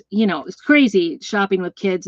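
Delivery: usual speaking voice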